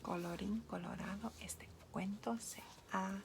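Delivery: whispered story ending. Acted. Closing formula of read story.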